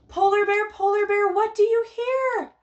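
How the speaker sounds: bright, high, loud announcing of the book title; excited title announcement. Acted. Teacher announcing the book title to toddlers, excited and high. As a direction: present the title like a big reveal.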